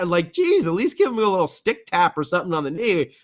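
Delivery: ordinary talk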